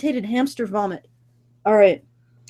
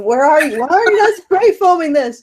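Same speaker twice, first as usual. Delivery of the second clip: loud, higher-pitched mock outrage; mock scolding; 4 semitones higher, 5 dB louder than usual. Podcaster calling out absent co-hosts, mock indignant. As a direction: playfully berate the missing co-hosts.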